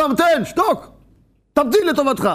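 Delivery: scolding someone who keeps nagging; harsh yell. Retold or imitated. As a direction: bark the order at him from above. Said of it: "צועקים עליו".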